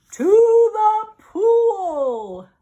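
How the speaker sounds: very slow, loud yell. Acted. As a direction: bellow the answer. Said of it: "yelled the fireman".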